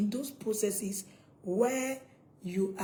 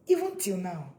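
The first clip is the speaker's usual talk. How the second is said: normal talking voice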